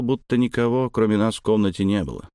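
usual speaking voice

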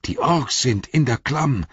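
put-on old warrior warning of orcs in the gorge; alarm call. A narrator's reading. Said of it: "rief er".

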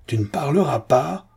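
high, insistent, exasperated demand. A narrator's reading. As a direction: press the threat harder, rising on PARLERAS. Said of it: "Exaspéré". (exaspéré, voix qui monte)

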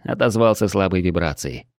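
usual speaking voice

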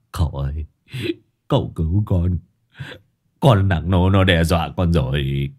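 low wailing plea; whimpering. Acted. Disciple begging master to save him, whimpering. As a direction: cry to your master for help. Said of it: "mếu máo".

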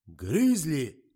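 очень громкий выкрик; выкрикивает глухой; громкая догадка. A narrator's reading. Охранник выкрикивает. (громкий выкрик-догадка)

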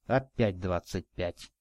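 low, slow, quiet exasperation; weary irritation held down, drawn out; irritated. A narrator's reading. Man hearing the boy refuse to explain, irritated. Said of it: "раздражённо всплеснул".

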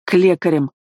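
short, grudging order; spat out. A narrator's reading. Son grudgingly takes father to healers, spat out. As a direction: spit it out with mild irritation. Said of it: "выплюнул я".

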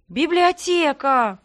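slow, drawn-out excited cry; excited exclaim. A narrator's reading. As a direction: exclaim it in delighted surprise. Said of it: "хором воскликнули".

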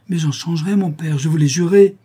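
high, urgent plea to a father; pleading protest. Acted. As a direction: insist earnestly, voice rising and swelling. Son protests to accusing father.

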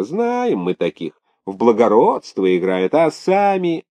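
scandalised tattle with high swoops; catty, gossipy maid. Acted. Maid telling the governor about a rival's evening visits, catty. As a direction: tattle cattily, stretching the knowing bits.

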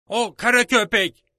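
high, loud, slow yell naming him; shouting. A narrator's reading. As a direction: cry it out so the whole room hears. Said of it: "diye bağırdım".

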